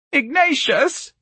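high, drawn-out tragic wail; distraught mother, stretched high; tragic cry. Acted. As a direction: cry the name out in motherly despair. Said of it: "Missus Riley cried tragically".

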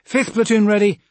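loud, high-pitched readiness call; echoed military report. A narrator's reading. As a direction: sound off like the officer before. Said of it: "echoed her words".

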